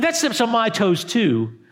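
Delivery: normal talking voice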